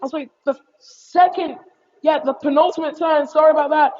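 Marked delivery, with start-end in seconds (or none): none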